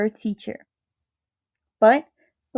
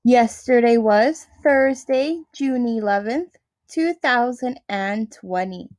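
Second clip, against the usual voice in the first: ordinary talk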